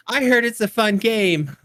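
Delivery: put-on high eager child voice; a keen little kid. Kid quoted about Monopoly.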